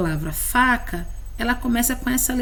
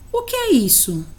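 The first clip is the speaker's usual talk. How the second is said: higher, bright teacher question; quizzing question; 10 semitones higher than usual. Acted. Teacher asks the child what the word is, higher. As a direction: ask it like a playful quiz.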